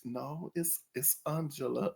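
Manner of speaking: soft, firm "it's Angela"; quiet firm correction. Retold or imitated. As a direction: set them straight, quietly. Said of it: "She was kinda like".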